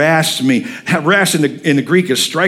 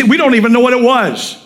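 ordinary delivery

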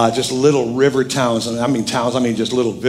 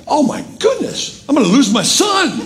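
mock panic; 11 semitones higher than usual. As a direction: play up a parent's panic, pitched way up. Retold panic over losing his son.

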